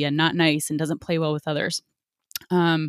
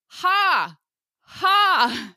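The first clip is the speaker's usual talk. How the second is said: high, exaggerated fake laugh; a sarcastic ha ha; fake mock laugh; 10 semitones higher than usual. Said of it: "And I just went".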